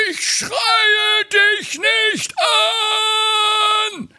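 hoch gebrüllter Wutausbruch; Hermann explodiert. Acted. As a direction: platzt endlich schreiend heraus. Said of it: "Warum schreist du mich denn dann so an". (Hermann schreit seine Frau laut und hoch an)

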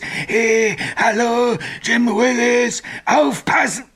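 high, urgent calling in full voice; frantic ghost call. Acted. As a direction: cry out the phantom's call in torment. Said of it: "Immer wieder ruft es". (hoch und gequält herausgerufen)

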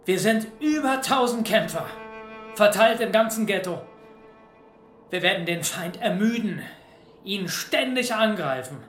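rousing speech. Acted. Leader rallying fighters before battle.

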